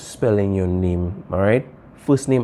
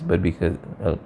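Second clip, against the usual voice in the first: usual speaking voice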